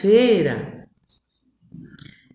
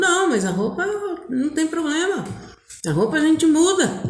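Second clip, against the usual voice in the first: higher-voiced retold brush-off; breezy reassurance; 7 semitones higher, faster than usual. Boy reassuring her about his clothes, breezy. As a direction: wave the objection away, voice lifted.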